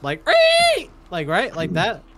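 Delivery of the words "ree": piercing high screech; pterodactyl shriek. Shown as a demonstration. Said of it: "What does a pterodactyl sound like?".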